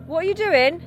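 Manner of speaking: high alarmed shout at the driver; alarmed yell. Real, not acted. Scared passenger yelling at driver.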